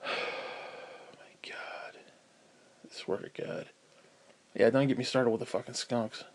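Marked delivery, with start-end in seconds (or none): 0.0-3.7 soft, slow whispered despair; exasperated whisper. Real, not acted. Telling viewers about the skunks, a slow weary whisper. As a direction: breathe it out, worn down.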